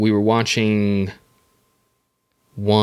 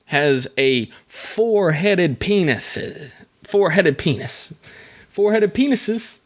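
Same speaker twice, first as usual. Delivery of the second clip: building disbelief; 7 semitones higher, slower than usual. Real, not acted. Repeats the absurd fact, building.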